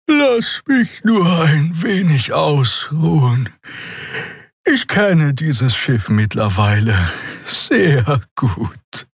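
a tired healer waving them off, slow and high; lazy healer telling them to go on without her, slow and high; sleepy healer voice. A narrator's reading. The healer Xia's voice.

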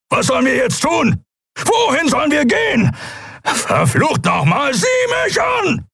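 very high, strained shouting; desperate rage, climbing; furious demands. A narrator's reading. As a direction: yell at them to face you. Kero yells demands at captain.

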